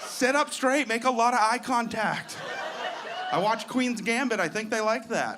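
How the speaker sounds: high, slow mock English accent; posh English accent. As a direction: play the proper Englishman sitting up straight. Mimicking posh English manners.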